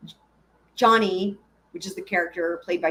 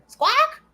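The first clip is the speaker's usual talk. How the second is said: bird squawk; 3 dB louder than usual. Pretend. Imitating a guard bird.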